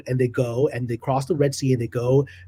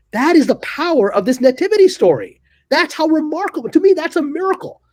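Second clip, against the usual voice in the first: awed; 9 semitones higher than usual. Real, not acted. Speaker marvels at the miracle.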